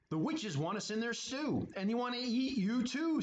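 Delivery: retelling the warning in a mild strained voice; skeleton voice. Acted. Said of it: "said Skeleton".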